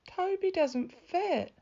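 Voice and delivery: put-on high, slow child's voice; a young boy's high, drawn-out complaint; little boy Tim. A narrator's reading. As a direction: plead it slowly like a small boy. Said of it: "Tim told Mum".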